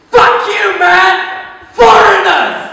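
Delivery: loud, high-pitched angry yell; fed-up shout. Acted. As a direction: hurl the insult as you storm off. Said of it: "At this point, he's fed up".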